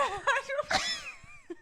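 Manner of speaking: very high shrieking laugh. Real, not acted. Laughing at roof joke.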